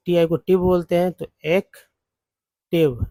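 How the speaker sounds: usual speaking voice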